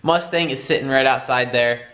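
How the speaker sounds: ordinary delivery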